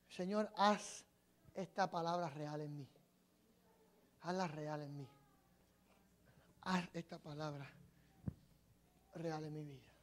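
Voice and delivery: soft, low, slow inward prayer; hushed prayer. Acted. Preacher models praying over his own message, hushed prayer. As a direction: pray it softly to yourself.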